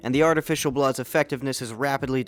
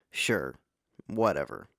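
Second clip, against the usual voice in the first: dismissive shrug; 7 semitones lower, 7 dB quieter than usual. Acted. Morbius shrugging off the victims.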